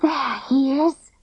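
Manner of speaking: sussurro sibilante e agudo; hissed. A narrator's reading. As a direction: sibila o aviso à amiga. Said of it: "hissed Clipper".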